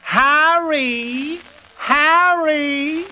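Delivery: high voice drawn from front of mouth to back of throat; high, drawn-out comic call that rises and falls. Shown as a demonstration. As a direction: pull each word back into the throat, high. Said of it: "draw a lot of his words from the front of his mouth to the back".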